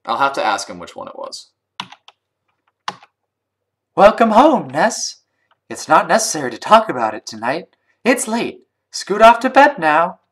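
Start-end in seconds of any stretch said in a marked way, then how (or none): 4.0-10.2 high, sing-song motherly voice; raised, caring mother character; game mom. Acted. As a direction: fuss over a child like a mom.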